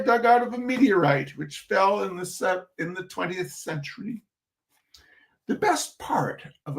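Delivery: measured reading-aloud voice for an audience; story narration. Acted. Reading a humorous essay to an audience, storyteller narration. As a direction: read the essay out with dry humor.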